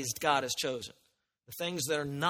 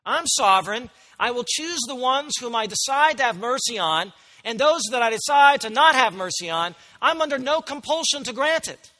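higher, louder, commanding declaration; voice of God; 7 semitones higher, 8 dB louder than usual. Retold or imitated. Preacher speaking as God to the church, commanding and loud. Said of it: "What does that sound like to you?".

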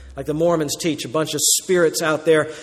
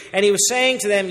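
ordinary delivery

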